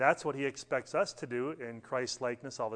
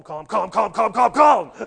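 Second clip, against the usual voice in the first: each calm louder and higher until it's a shout; building mock yell; 6 semitones higher, 13 dB louder than usual. Mother spanking her little boy, building into a yell. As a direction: repeat calm, rising into a yell. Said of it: "and she said".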